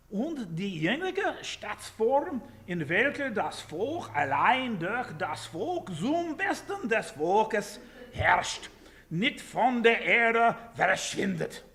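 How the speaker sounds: slow, high, booming German declamation; thunderous German proclamation; shouted German. Shown as a demonstration. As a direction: shout it out like a German orator, drawing out the words. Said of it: "You have to shout German".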